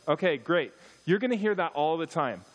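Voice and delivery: ordinary delivery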